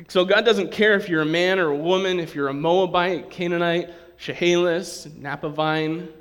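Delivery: loud, driving sermon voice; preaching. Acted. Pastor preaching to the congregation, full force. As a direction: preach it out to the whole room.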